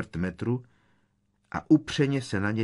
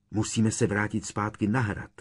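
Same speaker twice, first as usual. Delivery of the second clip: ordinary delivery, nothing marked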